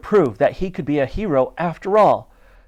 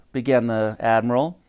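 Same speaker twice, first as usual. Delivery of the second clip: ordinary delivery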